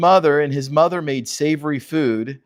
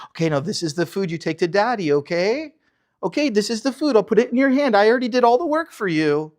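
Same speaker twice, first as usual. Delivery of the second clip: put-on higher, singsong baby talk; mock mommy voice climbing higher; doting mother; 6 semitones higher, faster than usual. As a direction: talk to him like a toddler. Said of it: "treated him like a little toddler".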